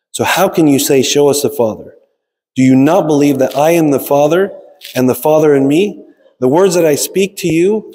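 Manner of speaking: ordinary talk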